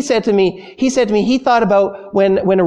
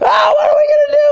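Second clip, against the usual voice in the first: mock panicked scream, much higher and louder, fast; hammed-up panic; 5 dB louder, faster than usual. Disciples bailing water, hamming up a panicked scream. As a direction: play the disciples losing it in the storm. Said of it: "all freaking out".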